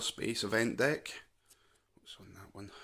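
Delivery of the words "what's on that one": whispered aside to himself; whispering. Real, not acted. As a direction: drop to a whisper, asking yourself. Muttering to self while sorting cards.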